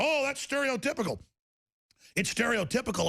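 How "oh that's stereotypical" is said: much higher, sneering mock objection; mocking whine. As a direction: mimic a prissy critic. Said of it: "people go".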